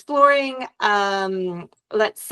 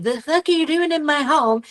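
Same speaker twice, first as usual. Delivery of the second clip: mock aggressive homeowner; 4 semitones higher than usual. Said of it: "and get aggressive".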